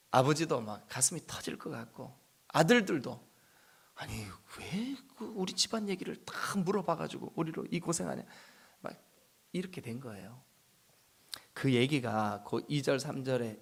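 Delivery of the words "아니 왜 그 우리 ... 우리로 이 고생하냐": whispered, muttered grumble; hushed blaming whisper; whispered complaint. Retold or imitated. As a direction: grumble under your breath. Father's quiet grumble voiced.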